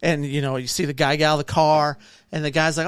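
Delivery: ordinary talk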